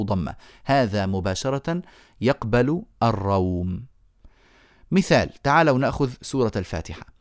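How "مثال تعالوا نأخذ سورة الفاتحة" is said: usual speaking voice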